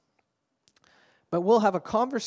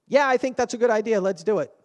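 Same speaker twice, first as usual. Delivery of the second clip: fast, loud, higher-pitched blurt; sudden blurt; 4 semitones higher, 7 dB louder, faster than usual. Acted. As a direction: blurt it out of nowhere, quickly. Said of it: "I just blurted out".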